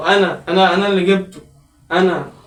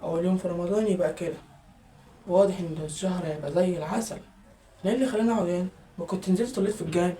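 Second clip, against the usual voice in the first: much softer grumbling to himself; muttered aside; 4 semitones lower, 13 dB quieter, faster than usual. Acted. Son grumbling quietly to himself after the scolding. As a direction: mutter it under your breath.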